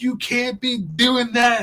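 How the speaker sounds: high, slow, mock-outraged scold; retold screaming lady. Mall lady scolding the pilot, in a high mock yell. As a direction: play the angry mall lady telling them off.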